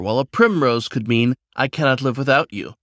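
usual speaking voice

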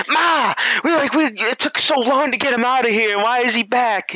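put-on a teenager complaining to his mom in a high whine; whiny son. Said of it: "the son's like".

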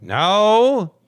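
high, loud drawn-out no; hammed-up outraged denial; mock indignation. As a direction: wail it as if deeply offended.

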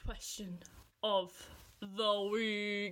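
slow drawn-out game show host; spaced-out dramatic game show reveal; dramatic announcer. Acted. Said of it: "Let me try, let me try".

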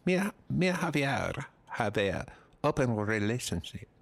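mock Spanish accent, halting; Penelope Cruz. Wife of Javier explaining their open relationship, Spanish accent. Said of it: "That was, that was a hundred percent".

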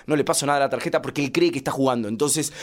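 ordinary talk

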